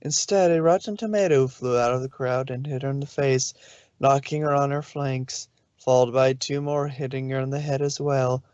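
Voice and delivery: ordinary delivery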